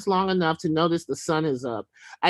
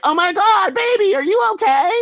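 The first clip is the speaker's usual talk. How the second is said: high, loud mock-sweet gushing; fake concerned gush; 7 dB louder than usual. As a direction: gush over him in a put-on high voice. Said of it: "I'm all like".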